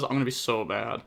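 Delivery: ordinary delivery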